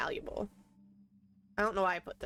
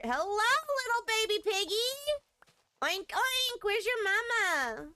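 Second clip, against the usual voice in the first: put-on much higher cooing baby talk; 12 semitones higher than usual. Greeting a baby pig in the game, cooing. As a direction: coo at the piglet.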